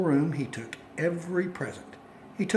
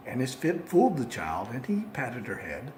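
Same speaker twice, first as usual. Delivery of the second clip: ordinary delivery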